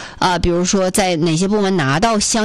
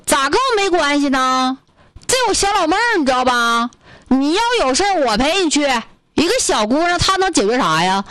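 put-on higher, protective Northeast accent; 6 semitones higher than usual. Northeast brother protects the girl from the woman, in Northeast accent. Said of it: "操着一口这个东北口音的大哥".

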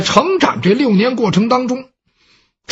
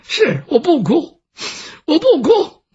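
crying; 6 semitones higher than usual. Acted. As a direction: voice climbing high while crying. 老太太忍泪说不哭. (哭着说不哭，声音越来越高)

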